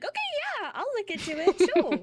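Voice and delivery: high, loud breezy agreement; playing dumb. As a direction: act clueless and eager. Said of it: "I acted dumb".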